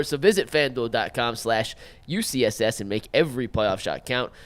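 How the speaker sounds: upbeat sponsor read, loud and punchy. Acted. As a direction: sell it like a radio spot.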